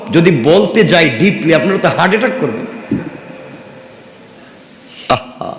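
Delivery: usual speaking voice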